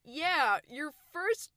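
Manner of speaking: mocking Alec's late clue about the stranger's car, sarcastic. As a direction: draw it out in disbelief. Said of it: "and I'm like".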